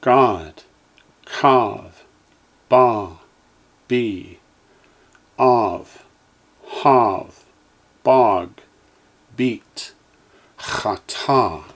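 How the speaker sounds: each syllable said slowly and clearly, with a pause after it; teacher's careful drill voice; Hebrew syllables for echoing. Shown as a demonstration. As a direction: model every Hebrew sound for the class to repeat. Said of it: "Line seven".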